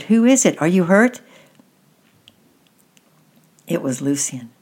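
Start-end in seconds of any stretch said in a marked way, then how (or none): 0.0-1.2 loud, high urgent questions; calling out. A narrator's reading. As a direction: call out with alarm to someone hurt. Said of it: "he cried".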